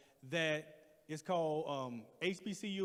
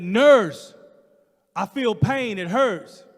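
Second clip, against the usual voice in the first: louder, higher pained cry; pained plea; 4 semitones higher, 19 dB louder, much slower than usual. Retold or imitated. Nervous patient crying out to the nurse in pain. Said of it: "And I said".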